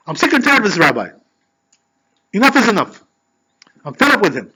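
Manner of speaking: fed-up acted outburst, punched phrases; frustrated. Husband fed up with the rabbi venting to wife, frustrated. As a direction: act out losing patience. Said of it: "he got frustrated".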